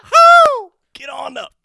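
very high, loud whoops. Acted. Disguised spy fighting henchmen, falsetto pop-star whoops. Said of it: "is that [name]?".

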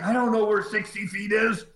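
high-pitched helpless complaint; acted-out confused protest; flustered mock protest. Flustered driver's protest, retold.

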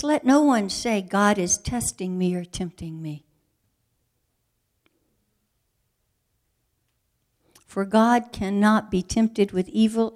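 loud, emphatic reading of James; preached loud; forceful scripture quote. Acted. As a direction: proclaim it with force.

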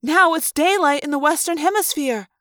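high-pitched, puzzled insistence; insistent. A narrator's reading. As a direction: press the point urgently. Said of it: "Cassie insisted".

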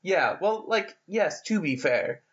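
normal talking voice

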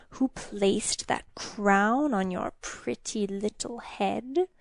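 soft, slow, sinister demon taunt; hissing creature. A narrator's reading. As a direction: hiss it softly, savoring the mockery. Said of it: "the creature hissed".

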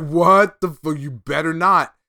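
high, loud mock horror at a spoiler; half-joking shocked protest; mock outrage. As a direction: act horrified that it might be Telos.

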